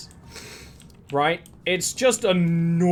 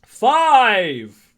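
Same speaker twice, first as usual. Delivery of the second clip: excited shout; 4 semitones higher, 8 dB louder than usual. Real, not acted. Reacting to five more chambers.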